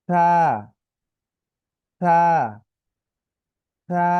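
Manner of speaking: তা with a soft th, tongue tip at the upper teeth; soft, short stretch. Shown as a demonstration. Teacher models the soft letter for pupils, lisped th in তা. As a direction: let তা start soft and hold it briefly. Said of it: "আর কম টান".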